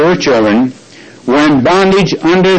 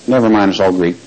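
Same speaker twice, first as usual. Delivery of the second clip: ordinary talk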